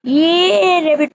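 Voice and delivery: high, loud happy whoop; excited cheer. Real, not acted. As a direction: yelp with joy at finding a rabbit. Finds a rabbit. (খুশিতে চেঁচিয়ে ওঠা)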